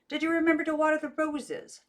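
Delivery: a lightly voiced husband asking; distant man's voice. A narrator's reading.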